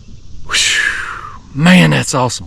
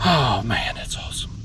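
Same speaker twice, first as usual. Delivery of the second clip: winded, soft and whispery; out of breath; 5 semitones lower, 10 dB quieter than usual. Real, not acted. Angler winded from reeling in fish, breathless awe. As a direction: sigh it out with no breath left. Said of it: "I'm a little bit out of breath".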